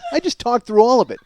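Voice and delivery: ordinary talk, nothing marked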